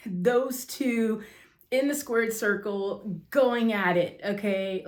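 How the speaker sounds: ordinary talk, nothing marked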